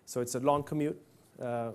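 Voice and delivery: ordinary talk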